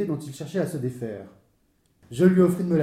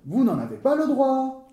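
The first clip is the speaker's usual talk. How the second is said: high, sharp comeback; angry official's high retort; irate retort; 9 semitones higher than usual. Acted. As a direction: cut him off angrily, pitched high. Irate official forbids the repairs.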